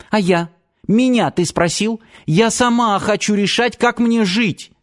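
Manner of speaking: high-pitched defiant granddaughter; indignant protest. A narrator's reading. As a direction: push back with rising indignation. Girl protests arranged marriage. (возмущённый протест на высоких тонах)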